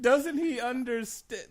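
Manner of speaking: high, slow mock plea; mock distress. Co-host lamenting the cousin's post, mock distress. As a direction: stretch it out in hammed-up distress.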